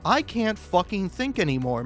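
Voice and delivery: usual speaking voice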